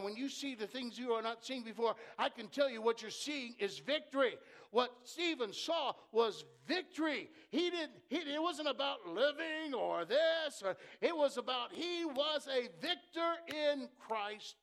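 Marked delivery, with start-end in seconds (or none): 4.7-14.6 slow, high, loud proclamation; shouting preacher. Real, not acted. Preacher proclaiming Stephen's victory, slow loud shout. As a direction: cry it out to the rafters.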